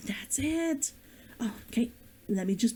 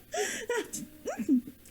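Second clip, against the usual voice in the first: helpless high-pitched laughter; can't stop laughing, giggle high; high giggling fit; 11 semitones higher, 4 dB quieter than usual. Real, not acted. Author losing it on camera, high helpless giggle.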